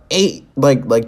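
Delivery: ordinary delivery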